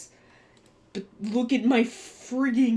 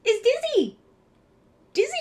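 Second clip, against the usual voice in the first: much higher, louder delighted shout; excited squeal; 13 semitones higher, 4 dB louder, much faster than usual. Real, not acted. Streamer spotting the Dizzy figure, squealing with delight. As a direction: burst out with sudden joy.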